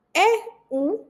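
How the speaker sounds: normal talking voice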